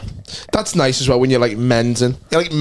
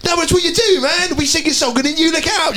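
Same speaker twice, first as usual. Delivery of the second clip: knobhead lad voice; 11 semitones higher than usual. Retold or imitated. Pushy lad pressing his mate to lick the table, shouting. Lad insisting in retold story.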